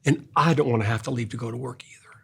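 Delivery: soft, hushed comfort sinking into a whisper; tender comfort. Retold or imitated. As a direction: hold the child close and murmur. Grandpa comforting grandson on lap.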